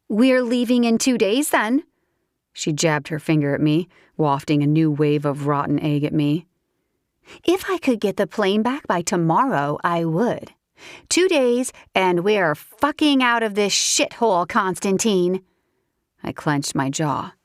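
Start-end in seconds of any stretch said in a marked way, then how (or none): 0.1-14.5 a higher, sharper furious woman's voice; haughty angry woman. Acted. Valentina's voice, angry. 14.5-15.4 Constantine hissed at the end in a higher woman's voice; Constantine as a furious final jab; spat name. Acted. Valentina spits his name.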